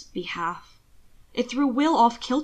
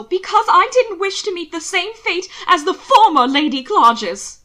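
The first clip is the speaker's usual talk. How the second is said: loud, higher cry of fear and blame; accusing shout; 8 semitones higher, 9 dB louder than usual. Acted. Will accusing his husband of murder, a loud cry. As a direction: hurl the accusation at him.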